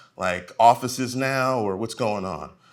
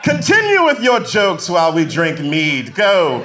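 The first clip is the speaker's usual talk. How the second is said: mock lordly dismissal; a hint of a snooty aristocrat; haughty noble; 4 semitones higher, 5 dB louder than usual. Rich audience dismissing the comedian, mock lordly. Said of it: "Some of you are like".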